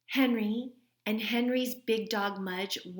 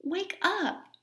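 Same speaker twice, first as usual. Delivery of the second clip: put-on high child voice pleading; high boy's voice urging his dog; 11 semitones higher than usual. A narrator's reading. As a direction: nudge the sleepy dog awake. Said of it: "said Henry, giving him a push".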